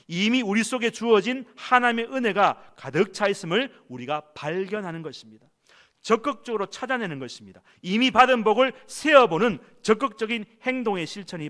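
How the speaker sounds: climbing to a loud, high emphatic call; rising preacher's shout. Real, not acted. Preacher to congregation, loud high rising exhortation.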